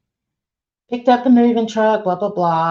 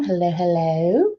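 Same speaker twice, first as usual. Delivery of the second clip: ordinary delivery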